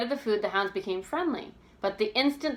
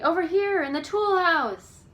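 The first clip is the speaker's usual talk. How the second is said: calling out; 9 semitones higher, 6 dB louder than usual. A narrator's reading. George guiding Bess to the tool house, loud call. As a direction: shout directions to be found.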